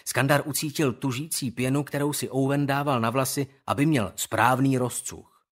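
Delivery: ordinary delivery, nothing marked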